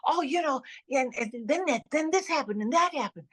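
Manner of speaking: storytelling in a high Southie voice; chatty South Boston accent. Shown as a demonstration. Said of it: "I'm talking like this all the time".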